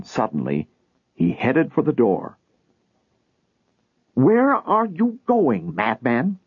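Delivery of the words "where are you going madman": high, drawn-out calling after him; called out. Acted. Wife calls after husband leaving at night, high and slow. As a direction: call him back as he heads out. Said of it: "her mother called".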